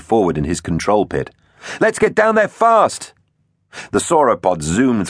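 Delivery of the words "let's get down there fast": very high, eager command; rally the crew to race off, pitched way up; urgent order. A narrator's reading. Captain's urgent order in battle.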